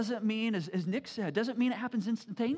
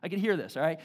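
ordinary talk

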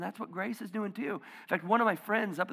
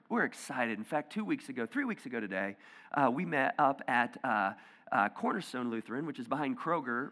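ordinary talk, nothing marked

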